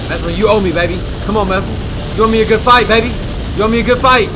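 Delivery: loud high taunting at the boss; hyped yelling. Real, not acted. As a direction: yell it out, hyped up.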